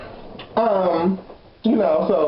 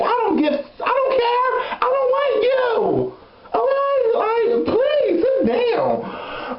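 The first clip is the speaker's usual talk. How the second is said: exasperated high rant; slower than usual. Real, not acted. Exasperated rant about the neighbor.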